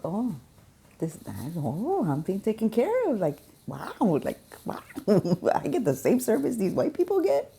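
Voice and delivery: high, loud mock astonishment; amazed customers. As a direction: imitate customers wowed by the service, pitch leaping up. Voicing amazed customers being well served.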